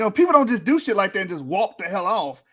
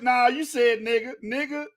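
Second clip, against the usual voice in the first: usual speaking voice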